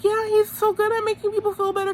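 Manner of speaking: very high mock-sweet voice; Teresa. Recapper mimicking a contestant praising him, high mock gushing. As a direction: chirp the praise in an exaggerated high pitch.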